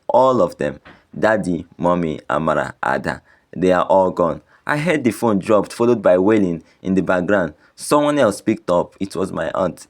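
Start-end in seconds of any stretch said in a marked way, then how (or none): none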